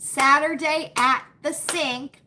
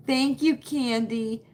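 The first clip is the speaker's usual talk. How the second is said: ordinary talk, nothing marked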